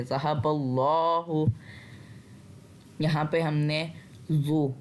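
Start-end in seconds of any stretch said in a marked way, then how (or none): 0.0-1.5 ज़हबल्लाहु with a heavy, full लाम in अल्लाहु; slow ज़हबल्लाहु, heavy ल्ला; heavy lam. Shown as a demonstration. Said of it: "मोटा पढ़ेंगे". (ज़हबल्लाहु में ल्ला को मोटा पढ़ें)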